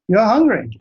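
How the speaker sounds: ordinary delivery, nothing marked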